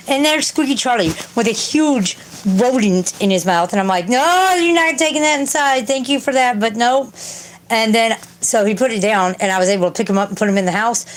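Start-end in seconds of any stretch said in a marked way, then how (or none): 4.1-7.1 retold scolding. Retelling her refusal to the cat.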